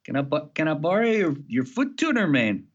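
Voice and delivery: high, imitating Mexican accent. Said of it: "I can't do a Mexican accent anymore".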